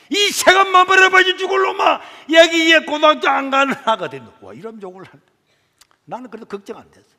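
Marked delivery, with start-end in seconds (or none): 0.1-4.3 high, loud cursing yell; furious shout. Retold or imitated. Mother curses her son for quitting school, shouting. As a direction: scream the curse at him.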